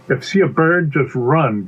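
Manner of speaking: firm instruction in an ordinary pitch; instructing bee. Acted. Bee teaches pea a wilderness rule, firm. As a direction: lay down the rule, falling on "run".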